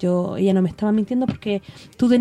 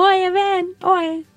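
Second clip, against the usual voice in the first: higher mock call down the street; 6 semitones higher, slower than usual. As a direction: call the neighbors over, pitched up. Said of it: "le gritaba así como".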